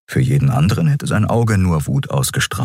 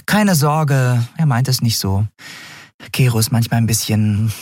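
higher, light comforting voice; friendly winged sprite, pitched up; reassuring little sprite; 6 semitones higher than usual. A narrator's reading. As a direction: soothe the newcomer in a higher small voice.

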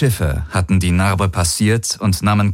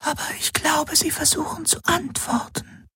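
very soft Helix whisper; 8 dB quieter than usual. A narrator's reading. The Shadow Helix reports a possible answer, whispered. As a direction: keep it to a hushed whisper. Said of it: "flüsterte die Schattenhelix".

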